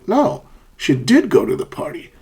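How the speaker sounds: insistent lift on "did"; emphatic stress. Shown as a demonstration. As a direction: contradict firmly by punching "did". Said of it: "for emphasis".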